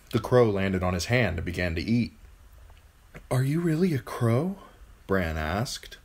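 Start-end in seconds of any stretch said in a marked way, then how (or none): 3.3-4.6 put-on higher-pitched boy voice; wondering boy. A narrator's reading. Bran questioning the talking crow, higher boy voice. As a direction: ask it with childlike doubt.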